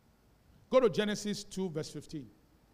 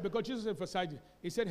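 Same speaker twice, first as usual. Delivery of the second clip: ordinary talk, nothing marked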